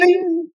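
very high mock chime, "bing"; ping it like a call bell; call button chime. Retelling the flight story, imitating the chime high.

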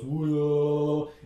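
motor noise. Retold or imitated. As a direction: mimic a little plane motor. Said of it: "the little motor goes".

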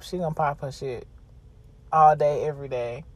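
normal talking voice

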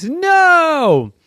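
high loud squealed no; sharp high piggy no; pig's refusal. Acted. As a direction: squeal it like an offended pig. Pig refuses in story.

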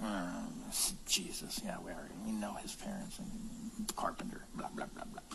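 whispered, mumbling mimicry of grumbling; mock grumbling whisper. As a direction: mutter it under your breath like gossiping locals. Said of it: "they're like grumbling amongst themselves".

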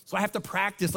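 ordinary talk

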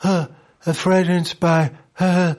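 lightly breathy ad voiceover; a hint of perfume commercial narrator; sultry perfume ad. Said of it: "inzwischen ist das alles immer nur".

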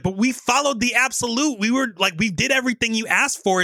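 lightly acted high-pitched pleading; pleading goblin. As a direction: plead your case as a goblin underling. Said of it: "pleading for their lives".

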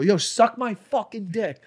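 high, drawn-out parting jeer; retold taunt. Comedian to heckler who is leaving, taunting. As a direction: re-enact a defiant send-off.

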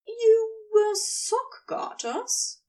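drawn-out teasing question, pitched well up; Gabriel asking. Acted. Gabriel teasing Crowley about his sock garters, slow and high. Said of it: "Gabriel asked".

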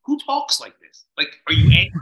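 ex-wife scoffing at his recovery jargon; mocking ex-wife. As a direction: throw it back at him, baffled. Imitating ex-wife's reaction.

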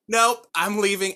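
loud, high-pitched retold refusal; emphatic refusal. Retelling his reaction to the voice, loud refusal. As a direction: blurt it out loud and high, acting out the moment.

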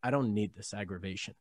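low, soft, calm voice. Retold or imitated. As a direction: say it quietly with no anger at all. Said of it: "I said very calmly".

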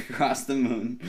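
usual speaking voice

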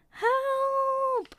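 very high, long-held mock wail; storybook cry for help. A narrator's reading. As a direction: stretch it out high, without real volume. Squirrel swept toward the falls.

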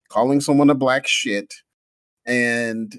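ordinary talk, nothing marked

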